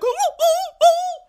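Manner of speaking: high, wailing dog howls; imitated dog crying. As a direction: mimic a dog crying out in long high hoots. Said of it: "the fucking dog crying like".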